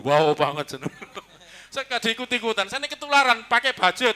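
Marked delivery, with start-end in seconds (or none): 0.0-0.8 "Wow" ditarik panjang dan lebih keras, suara dibuat beda; meniru gaya Heru. Retold or imitated. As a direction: tiru ucapan khas Heru dengan berlebihan. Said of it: "Istilahnya Heru". (menirukan seruan khas Heru di depan jemaat)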